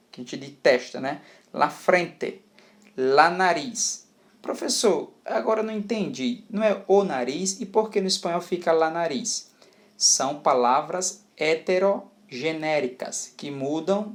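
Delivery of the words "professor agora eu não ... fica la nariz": higher-pitched, quicker pupil's question; puzzled student. Acted. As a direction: act a confused student asking the teacher. (aluno confuso, voz mais aguda)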